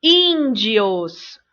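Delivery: "Índios" slow, first syllable held long; teacher's drawn-out ÍÍÍN-dios; stressed syllable. Shown as a demonstration. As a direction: stretch the ÍN in "Índios". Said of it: "Vejam que tem acento".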